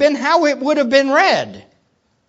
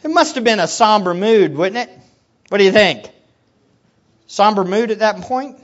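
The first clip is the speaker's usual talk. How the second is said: ordinary delivery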